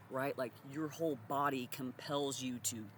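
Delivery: normal talking voice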